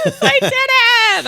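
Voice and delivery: high, slow, teary cry of victory; overjoyed and still half crying; tearful triumph. Retold or imitated. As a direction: squeal it through tears of relief. Backstage after pulling off the cry.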